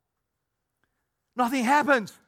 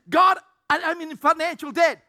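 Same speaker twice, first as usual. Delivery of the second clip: pleading cry; 6 semitones higher, 6 dB louder than usual. Acted. Believer crying to God.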